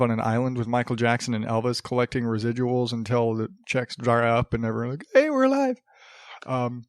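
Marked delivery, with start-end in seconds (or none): 5.1-5.8 high-pitched, jumping well up; mock excited shout-out. Supposedly dead stars announcing they're alive, mock excited. Acting out stars revealing they live.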